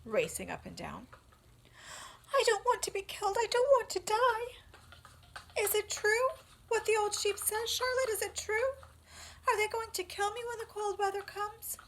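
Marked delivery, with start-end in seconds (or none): 2.3-11.8 much higher, louder, rushed pleading; scared pig begging Charlotte; frantic piglet. Acted. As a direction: gabble out fears. Wilbur panics, asks Charlotte.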